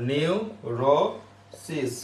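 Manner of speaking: Neurosis drawn out very slowly; slow dictation. Shown as a demonstration. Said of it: "তাহলে কি আসবে?". (Neurosis ধীরে টেনে বলা)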